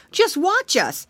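high, loud piglet; piglet crying out. Acted. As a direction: show off like a piglet. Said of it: "he cried".

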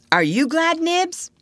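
put-on very high childish question; eager child. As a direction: ask the other boy in a piping child's voice. Child eagerly polls the others.

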